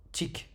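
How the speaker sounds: TIK cut off on the K; vowelless final letter. Shown as a demonstration. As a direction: stop dead on the K of TIK. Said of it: "avec la soukoun".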